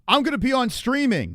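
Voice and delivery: high, loud stiff announcer; mock Matt R. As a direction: parody his phrasing. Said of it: "I should have said streaming".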